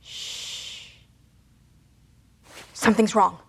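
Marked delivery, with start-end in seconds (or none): 0.0-0.9 sharp soft shush; shushing the others to silence. Acted. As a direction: hush them at once. Hushing the group.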